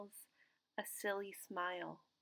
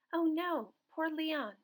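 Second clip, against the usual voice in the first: higher, louder sudden worry; boy suddenly scared for his fish; alarmed; 6 semitones higher, 5 dB louder than usual. Acted. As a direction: cry out in sudden fear for the fish.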